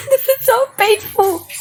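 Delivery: high, squeaky lament; anguished fan squeal; pained wail. Real, not acted. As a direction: whine it high, as if hurting. Fan grieving over a character's death.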